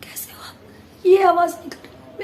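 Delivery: whispery croak with no voice behind it; lost voice, only air coming out; effortless, voiceless demo. Shown as a demonstration. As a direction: show how little sound comes without pushing. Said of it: "normal बोल के दिखाओ मैं आप लोगों को, बिना ताकत लगाए".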